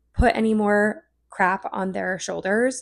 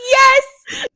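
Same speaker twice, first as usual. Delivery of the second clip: put-on squeaky old lady yes; 14 dB louder than usual. Character voice reply.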